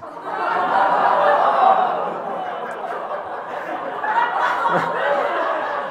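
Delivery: long unvoiced wheezing laugh; breathy laugh. Real, not acted. Long laugh at own joke.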